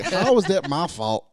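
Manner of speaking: high, loud, laughing self-defense; indignant protest. Retold or imitated. Husband defending himself to his jealous partner, indignant protest. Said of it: "I'm like".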